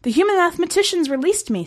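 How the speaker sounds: high, mildly miserable soldier voice. A narrator's reading. Soldier admitting release to commander, miserable. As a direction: confess it high, with a touch of misery. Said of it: "with the utmost misery in its voice".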